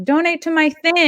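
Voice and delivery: high, pushy sales pitch; mock rally grifter. Mocking rally hustlers asking for donations, high pitchy plea. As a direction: wheedle like someone begging for cash. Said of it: "but then they're like".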